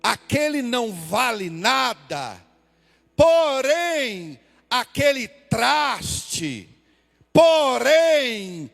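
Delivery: loud high "porém" each time, building to a slow shouted finish; preaching build. Acted. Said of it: "como se Deus estivesse falando assim". (pregação crescendo, cada "porém" mais forte até o grito final)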